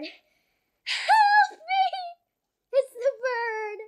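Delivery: high crying scream for help; phobic panic. Real, not acted. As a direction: panic at the bird, half crying. Bird scare, crying for help.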